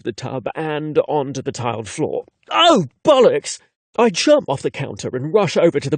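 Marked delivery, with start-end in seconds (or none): 2.5-3.6 loud high startled outburst; startled exclamation. Acted. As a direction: yelp at the overflowing bath. Bath overflowing, startled.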